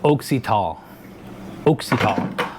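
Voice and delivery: Oxytol as OHK-see-tawl, long O first, falling; OHK-see-tawl for Oxytol, set out as a demonstration; company's pronunciation. Retold or imitated. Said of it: "it sounds like".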